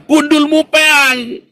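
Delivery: high, loud, drawn-out scoff; hammed-up divine put-down; God's mocking retort. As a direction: shout back a scornful answer from heaven. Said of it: "Tuhannya bilang".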